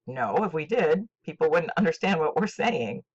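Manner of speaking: ordinary delivery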